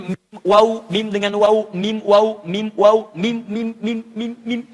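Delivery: mim and waw clearly closing and rounding the lips; lip sounds. Shown as a demonstration. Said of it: "ada yang di bibir". (mim dan waw dengan bibir jelas)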